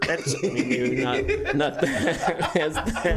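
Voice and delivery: usual speaking voice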